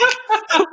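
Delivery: high, helpless laugh out of nowhere; a real shocked laugh escaping; burst of laughter. Real, not acted.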